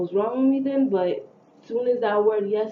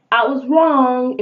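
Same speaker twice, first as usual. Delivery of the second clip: loud exclamation; 5 semitones higher, 11 dB louder, slower than usual. Real, not acted. Exclaims over her misjudgment, much louder.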